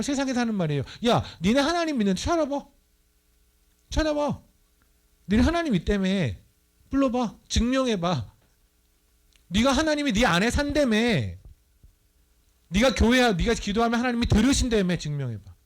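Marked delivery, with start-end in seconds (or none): 1.1-7.3 high, jeering mock of believers; mocking taunt. The world jeering at believers, high and taunting. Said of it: "세상의 조롱이에요". 7.5-8.3 high, sneering prove it; mocking dare. The world mocking believers, a high sneering dare. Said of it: "세상의 조롱이에요". 9.5-15.0 high, jeering mock of believers; mocking taunt. The world jeering at believers, high and taunting. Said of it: "세상의 조롱이에요". 15.0-15.5 quiet taunt. Retold or imitated. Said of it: "세상의 조롱이에요".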